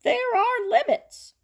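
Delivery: high, loud pulpit voice with a laugh; rally the town from the pulpit; preaching pastor. Acted. Pastor addresses town meeting.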